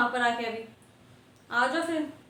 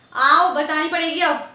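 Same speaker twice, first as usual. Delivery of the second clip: stern demand; 9 dB louder, slower than usual. Acted. Teacher orders student to confess.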